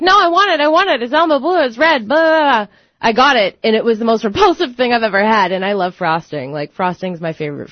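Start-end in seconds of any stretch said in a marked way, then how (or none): 0.0-2.7 put-on high, rushing kid insistence; child begging Mom, pitched up; whiny begging kid. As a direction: plead like a stubborn little kid. Acts out her childhood begging.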